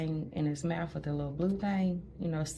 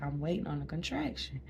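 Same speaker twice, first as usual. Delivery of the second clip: ordinary delivery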